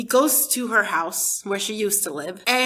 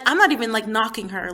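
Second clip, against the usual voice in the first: usual speaking voice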